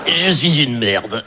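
slow, pathetic little voice for the trembling dog; slow, pitiful voice of a dog caught mid-business. Pretend. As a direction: make the dog sound feeble and ashamed.

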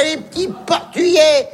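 normal talking voice

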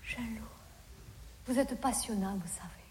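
breathy hushed flattery, low and soft; seductive whisper. Acted. She flatters her date in a soft low whisper. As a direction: whisper it to charm him. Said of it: "j'ai fait".

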